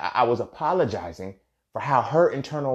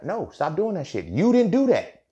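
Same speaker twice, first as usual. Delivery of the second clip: emphatic scolding; 7 semitones higher than usual. Real, not acted. Speaker firmly telling himself to stop apologizing. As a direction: lean hard into the denial. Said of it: "he scolds his own habit".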